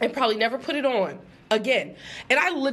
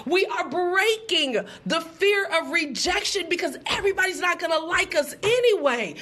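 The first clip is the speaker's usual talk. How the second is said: preaching declaration; 4 semitones higher than usual. Real, not acted. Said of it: "preaching declaration".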